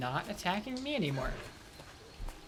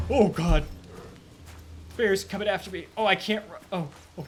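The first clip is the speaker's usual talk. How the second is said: panicked; 6 dB louder, faster than usual. Real, not acted. Chased by bear.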